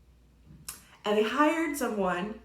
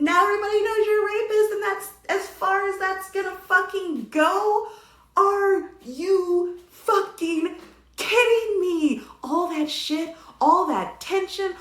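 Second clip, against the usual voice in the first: loud, high indignation; outraged rant; 6 semitones higher, 4 dB louder than usual. Real, not acted. Reviewer fuming at the film's ending, outraged. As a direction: raise the voice, stretch words in disbelief.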